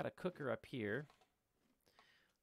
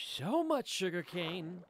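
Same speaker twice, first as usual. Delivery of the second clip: amazed; 7 semitones higher, 7 dB louder, slower than usual. Real, not acted. Amazed at sugar cane field.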